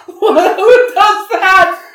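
very high, loud, slow disbelief; can't believe anyone would do that; incredulous. Real, not acted. Mocking the friend's excuse, shrieking in disbelief.

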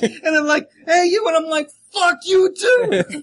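loud, high, hammed-up Jersey accent. Jersey newscaster telling of the risen dead, shouted Jersey accent. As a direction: holler it like a mock Jersey local. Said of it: "I thought that was Jersey".

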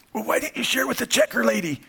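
put-on little boy's scratchy voice. Preacher imitates little Justice questioning mom, high scratchy child voice. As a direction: pipe up like a questioning little boy. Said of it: "in his little scratchy voice".

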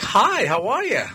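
very high, slow, overly polite greeting; polite safe voice. Retold or imitated. Greeting a police officer at a traffic stop, very high and overly polite. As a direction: sound as harmless as possible, very high and slow. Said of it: "I'm gonna be like".

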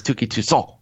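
Touki Toussaint said like too-KEE too-SAWN; French ending on Toussaint, final t silent; French-style pronunciation. Shown as a demonstration. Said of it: "If you wanna put that French on it".

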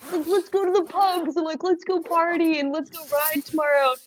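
high, excited invitation; eager fans. Retold or imitated. Festival fans inviting the riders out, imitated in high, eager excitement.

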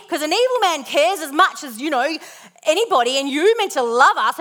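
high, loud, tearful rant at God; angry crying complaint. Retold or imitated. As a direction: pour out your anger at God through tears. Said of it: "I say".

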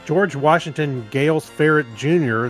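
ordinary delivery

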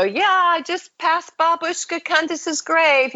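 high, jokey quip for the camera; joking. Retold or imitated. Traveler taping for parents as they pass the cemetery, joking. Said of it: "you know, kind of joking".

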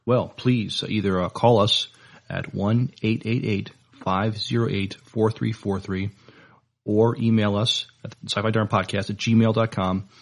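ordinary talk, nothing marked

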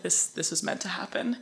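soft, half-whispered retold parting line; hushed conviction. Man parts from her after shaking hands, hushed conviction. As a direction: breathe it out as if awed by destiny.

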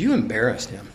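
low, soft accusation; quiet retold reproach. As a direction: report the complaint low and under the breath. Retold reproach, first take.

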